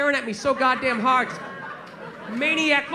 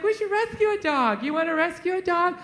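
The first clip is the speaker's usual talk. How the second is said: mock the girlfriend pushing for a rescue dog; 3 semitones higher than usual. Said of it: "She's like".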